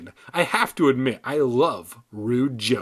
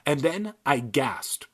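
ordinary delivery, nothing marked

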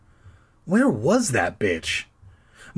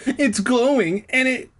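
much higher, slow and frightened; high and shrill; 14 semitones higher, 3 dB louder, slower than usual. A narrator's reading. Scared boy tells mom the head glowed, high and shrill. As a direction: cry it out, pitched way up. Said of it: "my voice high and shrill".

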